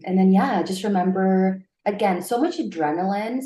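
usual speaking voice

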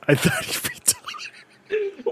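high, slow squeak breaking into laughter; quoted commentator. Retold or imitated. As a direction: squeak out the quote, barely holding it together. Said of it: "commentator who says".

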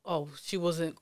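ordinary delivery

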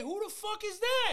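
high, loud, slow disbelief; baffled yelling. Retold or imitated. Clerk asks about the unknown rapper, baffled yelling. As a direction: yell it, utterly clueless.

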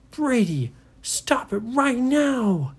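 high, loud, slow angry yell. Acted. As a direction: shout him down, furious. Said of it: "he was yelling at Brady".

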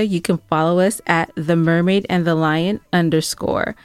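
normal talking voice

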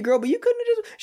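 high, squeaky comic protest; mock exasperation. Loving husband protests wife's needless call, mock exasperation. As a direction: plead in exaggerated disbelief. Acted exasperated reply to wife.

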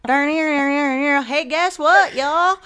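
loud, high Kentucky twang parody; mock Kentucky accent. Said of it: "Am I typing in Kentucky".

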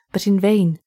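ordinary delivery

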